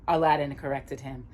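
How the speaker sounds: ordinary delivery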